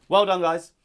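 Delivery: ordinary talk, nothing marked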